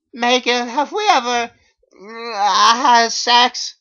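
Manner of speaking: very high, loud nervous voice; shrill awkward plea. Acted. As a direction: ask it in squeaky embarrassment. Awkward question on phone.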